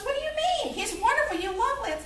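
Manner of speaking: high-pitched, baffled protest; incredulous mother. Retold or imitated. Mother protesting to newlywed daughter on phone, incredulous. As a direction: start very high, imitating a mother's disbelief.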